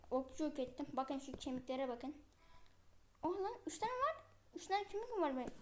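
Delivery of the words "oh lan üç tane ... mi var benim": sudden high surprised outburst. Real, not acted. Gamer spotting bones in his inventory, startled surprise. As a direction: caught off guard, voice jumping up.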